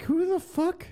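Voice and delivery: high disbelieving exclamation; incredulous. Retold or imitated. Reading the speaker list, high incredulous scoff. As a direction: scoff at a list of unknown names. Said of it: "I'm like".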